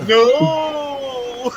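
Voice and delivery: long, high comic "no"; talking fist. Retold or imitated. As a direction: stretch out a high mock refusal. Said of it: "Your fist says".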